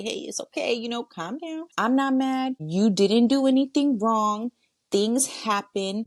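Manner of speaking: high, encouraging comfort; reassuring a child. Acted. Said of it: "I'm talking to that kid".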